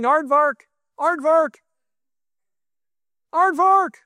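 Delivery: high, pointless calling out of a word; random proclamation. Shown as a demonstration. Preacher standing before the congregation, mock proclaiming a random word. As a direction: announce a nonsense word like a prophet. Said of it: "me standing here and going".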